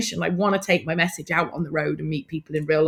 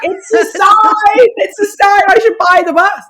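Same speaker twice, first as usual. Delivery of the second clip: high, loud, thrilled chant of conviction; giddy revelation; 13 semitones higher, 9 dB louder than usual. Retold or imitated. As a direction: shout it like fate just spoke to you. Tipsy revelation after the storm.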